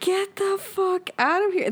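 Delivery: high, slow mock disbelief, get the fuck out. Friend retelling dismissing the lying ex's text, high slow mock disbelief.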